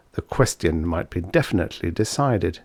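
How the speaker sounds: plain reading voice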